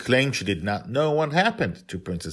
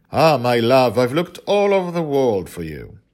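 higher, louder, grand prince voice; gallant prince; 6 semitones higher, 8 dB louder than usual. A narrator's reading. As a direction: sweep in like a storybook prince.

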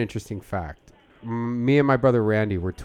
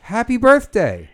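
cheerful birthday wish; 11 semitones higher, 7 dB louder than usual. Acted.

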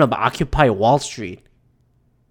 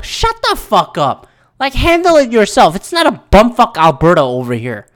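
exasperated, raised-pitch dismissal; angry rant; 4 semitones higher than usual. Real, not acted. Ranter rebuking officials asking for federal help, angrily exasperated. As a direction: snap back, fed up and forceful. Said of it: "That's my rant".

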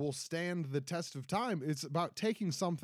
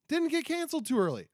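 worked up; 8 semitones higher, 5 dB louder than usual. Real, not acted. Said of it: "And I'm getting worked up".

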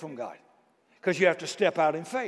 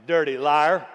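ordinary talk

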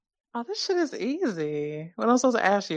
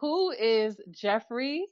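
ordinary delivery

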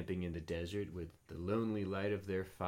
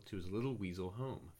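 ordinary delivery, nothing marked

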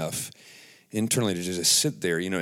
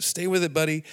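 pitched up to cheer himself on; higher self-encouragement; 7 semitones higher, faster than usual. Retold or imitated. Urging himself through shame, higher. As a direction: lift the voice like a coach to a buddy. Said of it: "It's like, whoop".

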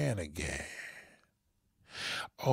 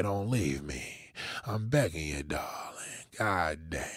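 mock pleading whisper; 6 semitones lower, slower than usual.

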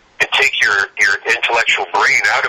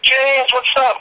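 put-on high, bright hail to an old friend; upbeat greeting; 13 semitones higher, much slower than usual. Ron greeting his old buddy at the gym, high excited hail. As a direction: call out a happy hello, pitched way up. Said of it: "He's like".

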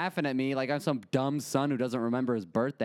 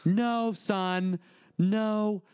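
higher, insistent mom butting in; mom cutting in; 6 semitones higher, much slower than usual. Retold or imitated. Mom interrupting her son in front of the IRS lady, insistent. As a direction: cut him off like a bossy mom. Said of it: "my mom's interrupt".

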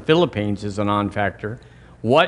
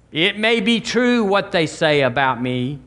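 normal talking voice

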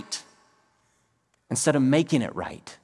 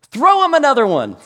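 much louder, much higher umpire shout; 11 semitones higher, 9 dB louder than usual. Retold or imitated. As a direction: bellow the call across the field. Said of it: "He misses that one".

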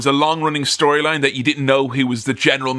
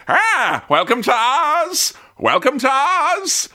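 high, drawn-out goblin greeting; little goblin man; 9 semitones higher, slower than usual. Retold or imitated. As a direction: stretch out a high mock-goblin welcome. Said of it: "little goblin man with a monkey".

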